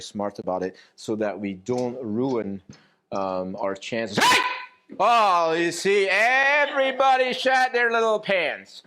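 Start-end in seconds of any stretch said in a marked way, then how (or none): 4.2-8.8 sudden loud, high shout; raised voice. Shown as a demonstration. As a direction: burst out loud to startle the room. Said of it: "my voice was a little bit louder".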